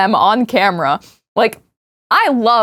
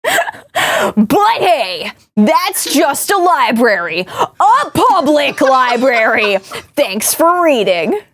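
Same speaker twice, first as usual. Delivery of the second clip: YouTuber outro voice; 2 semitones higher, much slower than usual. Pretend. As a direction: wrap it up like a YouTuber. YouTuber sign-off parody.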